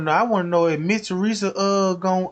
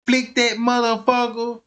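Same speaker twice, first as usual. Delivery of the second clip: ordinary delivery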